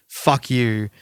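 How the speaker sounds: normal talking voice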